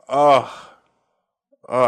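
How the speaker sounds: ordinary delivery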